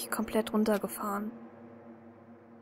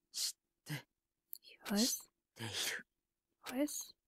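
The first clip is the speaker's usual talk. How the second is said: whispered, softer, eerie; mysterious whisper; 12 dB quieter, much slower than usual. Acted. A strange dream voice speaks in the narrator's head, eerie whisper. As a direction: breathe it like a voice inside the head.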